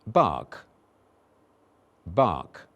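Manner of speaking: "Bark" twice, long open ah vowel; vowel demo. Shown as a demonstration. Teacher modelling the vowel in bark. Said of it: "First I said".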